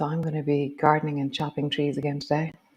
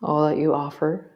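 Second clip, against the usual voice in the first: guided movement cue. Acted. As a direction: guide the body gently through each move. Leads a guided movement practice.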